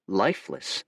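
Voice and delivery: ordinary delivery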